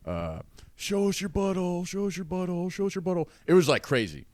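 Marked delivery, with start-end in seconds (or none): 0.8-3.3 lightly voiced drunk heckler; imitating a heckler. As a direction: mimic the guy yelling from the crowd. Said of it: "just starts screaming".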